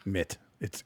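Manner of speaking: ordinary talk, nothing marked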